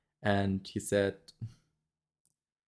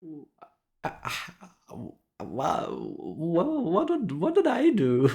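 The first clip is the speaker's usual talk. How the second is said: bewildered protest; 9 semitones higher, much slower than usual. Retold or imitated. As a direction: climb into a high panicked what-did-I-do. Bewildered reply to angry doctor.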